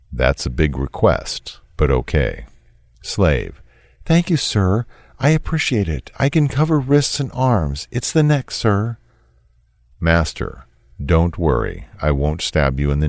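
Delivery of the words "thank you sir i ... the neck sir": reads the slave's text in a higher, meeker voice; a higher voice for the slave's messages; a timid, deferential texter, lightly voiced; lightly voiced submissive texter.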